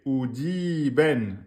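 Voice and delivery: Oudi ben read slowly, syllable by syllable; slow reading demo. Shown as a demonstration. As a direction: spell it out slowly for the class. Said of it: "on a bien oudi ben".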